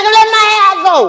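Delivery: high, loud mock holler; loud, high-pitched demand; acted screaming. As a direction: re-enact screaming at someone pulling your hair. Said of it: "I'm screaming".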